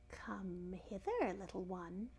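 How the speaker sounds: slow, soft, luring crocodile. A narrator's reading. Crocodile calling the elephant's child closer, slow and luring. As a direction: coax softly, drawing the words out. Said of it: "said the crocodile".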